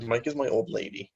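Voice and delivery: usual speaking voice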